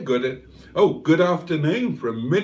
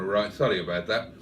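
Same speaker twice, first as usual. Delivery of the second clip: usual speaking voice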